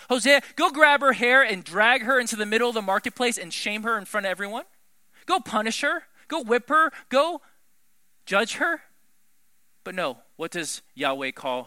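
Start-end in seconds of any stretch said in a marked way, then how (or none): 0.1-8.8 loud, high, urgent demands to punish; harsh command. Acted. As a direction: bark out the calls for punishment. Preacher voices harsh crowd command.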